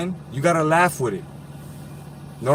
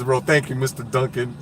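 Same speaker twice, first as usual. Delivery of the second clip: ordinary delivery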